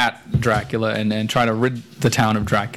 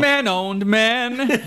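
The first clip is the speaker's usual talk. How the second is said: mock voice; 11 semitones higher, much slower than usual. Sarcastic high mock voice.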